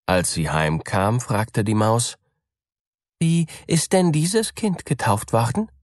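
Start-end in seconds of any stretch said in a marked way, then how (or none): 3.2-5.7 high, eager little mouse voice; a small innocent question, pitched up; curious mouse. A narrator's reading. Mouse asking the cat, high and eager.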